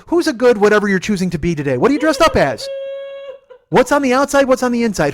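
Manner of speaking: put-on high, loud baby-talk questions; mock pet talk. Driver to passenger as if a cat, hammed-up pet talk. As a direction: gush at them as if at a pet. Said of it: "It's like talking to a cat".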